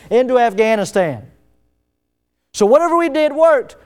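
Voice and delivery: high, loud, driving sermon delivery; impassioned pulpit volume; full-force preaching. Real, not acted. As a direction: push the point hard, pitched high and loud.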